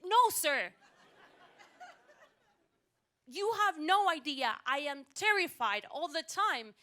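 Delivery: loud, high, emphatic scolding; indignant. Acted. Passenger telling the driver she is terrified, indignant. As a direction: cut him off and tell him off loudly.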